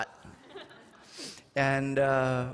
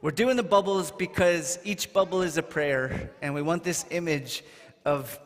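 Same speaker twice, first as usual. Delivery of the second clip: ordinary delivery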